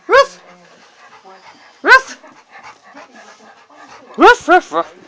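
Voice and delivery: high playful ruff ruff barks; imitated dog bark. Owner playfully barking at the puppy, high mock barks.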